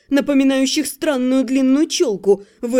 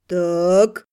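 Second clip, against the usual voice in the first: low gritted warrior voice; 7 semitones lower than usual. A narrator's reading. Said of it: "процедил воитель".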